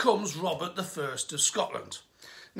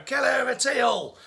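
put-on high, loud schoolboy French phrases shouted in a French accent; hammed-up French-accented child shouting; mock French kid; 4 semitones higher, 4 dB louder than usual. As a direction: yell random French like a cheeky French kid taunting. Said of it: "the little French kid's there going".

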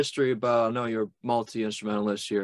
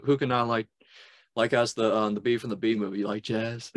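ordinary talk, nothing marked

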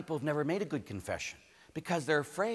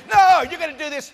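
high, loud shouted order; mock scolding priest; 11 semitones higher, 13 dB louder than usual. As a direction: shout it down at the sinner. Acted priest scolding an unrepentant sinner.

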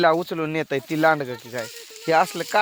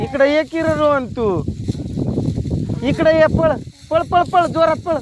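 shouting to run; 9 semitones higher than usual. Real, not acted. Urging Rohan to run over, high excited shouting.

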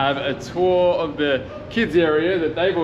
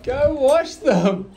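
slow, high, giggling telling-off; laughing scold; 7 semitones higher than usual. Real, not acted. Mother scolding child, laughing.

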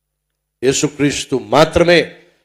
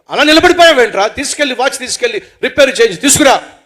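high, loud scolding; impatient, heated command; angry order; 7 semitones higher, 7 dB louder than usual. Acted. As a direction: shout at a friend to get moving. Angry friend orders a repair.

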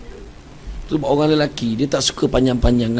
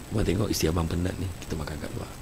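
soothing husband; 6 semitones lower, 9 dB quieter, much faster than usual. Retold or imitated. Husband soothing his wife.

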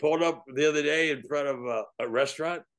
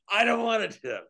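mild retold yell; 7 semitones higher than usual. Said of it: "he yelled it worse than that".

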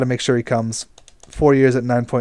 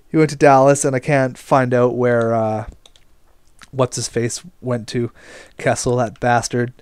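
ordinary talk

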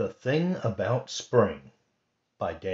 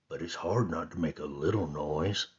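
softer, hushed bear voice; soft whisper; 5 semitones lower, 6 dB quieter than usual. Acted. Bear admitting to Rabbit it's hard to be quiet, hushed. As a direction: keep it hushed, apologetic. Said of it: "whispered Bear".

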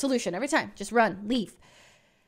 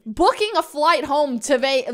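ordinary delivery

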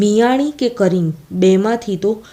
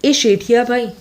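higher-pitched call for help; the woman calls the narrator for help, a higher-pitched mild shout; 5 semitones higher than usual. A narrator's reading. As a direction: call to the merchant for help across the room. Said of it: "ને મને બૂમ પાડી".

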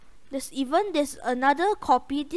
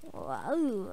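ordinary talk, nothing marked

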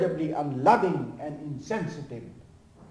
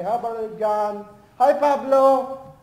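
cheerful church greeting; 6 semitones higher, 6 dB louder than usual. Acted.

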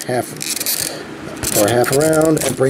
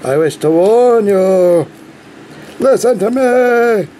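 pompous alien warning; 5 semitones higher, slower than usual. Pretend. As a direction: grandly warn the child of doom. Tobias voice bit again.

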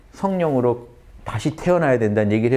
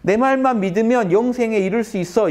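high, bright-raised voice of Jesus, sure of it; voicing Jesus; 9 semitones higher than usual. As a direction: speak as Jesus, pitched well up and certain. Preacher voicing Jesus' promise.

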